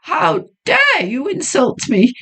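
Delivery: high, outraged woman's voice; voicing the heroine in wounded pride; indignant young woman. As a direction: flare up at the insult.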